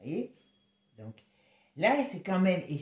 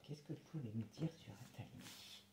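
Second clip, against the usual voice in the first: hushed, focused request to the cards; speaking softly to concentrate; 5 semitones lower, 18 dB quieter than usual. Real, not acted. Card reader quietly asks the tarot about Attali, hushed. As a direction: murmur the question low and intent. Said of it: "quand je parle doucement, c'est que je me concentre".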